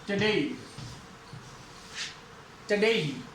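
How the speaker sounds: टुडेई with a short, reduced first vowel, "tuh-DAY"; pronunciation demo. Shown as a demonstration. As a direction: model टुडेई as "tə-DEI". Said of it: "ओ क्या sound देगा अब यहाँ पे".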